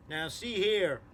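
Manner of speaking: firm police shout upward; stern shout. Acted. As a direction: call up through cupped hands. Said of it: "shouted the chief of police".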